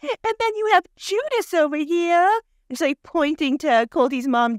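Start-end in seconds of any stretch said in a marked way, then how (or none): 0.0-2.4 high, mock-tearful older lady; mock outraged lament. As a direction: wail it in hammed-up indignation. Mimics Miss Debbie's outraged lament.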